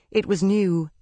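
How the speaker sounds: usual speaking voice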